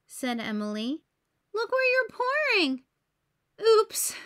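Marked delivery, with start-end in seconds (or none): 1.5-2.8 high, louder story-voice reproach; alarmed scolding. A narrator's reading. Emily tells James to watch the sauce, alarmed scolding. 3.6-4.2 high, loud little exclamation; startled oops. A narrator's reading. James sees the sauce he spilled, startled oops.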